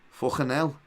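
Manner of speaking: put-on Scouse accent. Host put on the spot by a viewer, trying out Scouse. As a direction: exclaim it in a put-on Liverpool accent. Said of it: "some more Scouse accent".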